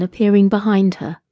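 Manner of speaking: plain reading voice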